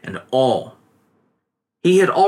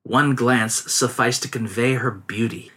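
usual speaking voice

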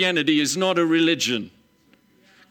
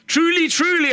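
Truly, truly called out high and loud; preacher making the crowd pay attention; emphatic; 12 semitones higher, 4 dB louder than usual. Acted. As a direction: hammer both words to grab attention.

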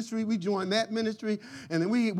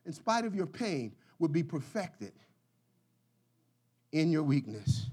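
soft quoted scripture; 5 semitones lower, 6 dB quieter than usual. Acted. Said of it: "he said".

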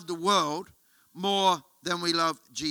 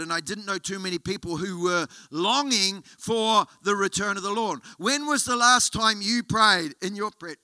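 normal talking voice

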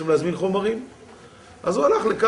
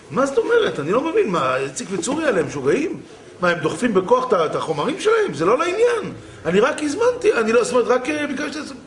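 indignant quoted outburst, pitched up; retold angry protest; 6 semitones higher than usual. Customer to delivery driver over unordered materials, indignant. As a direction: protest heatedly as the bewildered customer. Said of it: "וצעקות".

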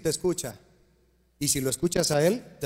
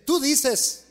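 the Lord rebuking; 8 semitones higher, much slower than usual. Acted. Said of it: "El Señor le dice a la Odisea".

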